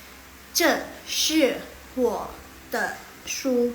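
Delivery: slow, syllable by syllable Mandarin; slow model reading. Shown as a demonstration. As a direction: read it out slowly for the class. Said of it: "Laoshi udah bantu baca nih". (membaca pelan untuk murid)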